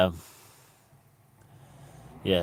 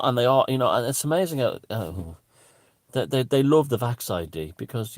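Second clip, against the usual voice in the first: ordinary talk, nothing marked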